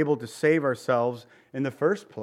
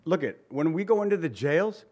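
usual speaking voice

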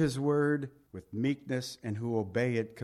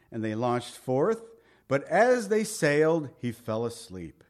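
louder, measured Bible reading; lightly raised scripture reading voice; 2 semitones higher, 4 dB louder than usual. Acted. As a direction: read the passage out to the congregation. Reads scripture aloud.

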